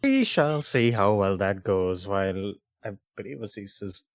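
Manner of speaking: usual speaking voice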